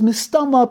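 mistama lifted high and leaned on; stress on mistama. Shown as a demonstration. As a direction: lean hard on mistama. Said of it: "There's a stress on the word mistama".

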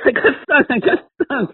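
very high panicked squeal; mock panic. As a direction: imitate a grown man squealing in alarm. Acting out stung golfer panicking.

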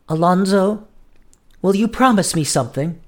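a hint of an anxious sweetheart asking; lightly voiced pleading girl. A narrator's reading. Julia anxiously asks a promise.

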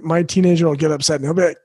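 ordinary talk, nothing marked

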